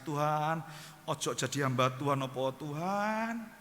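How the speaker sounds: slow, drawn-out mock wail; retold tearful pleading. Retelling his prayer to God on the overpass, tearfully pleading. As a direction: plead with long, dragged word endings. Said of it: "saya sampai nangis".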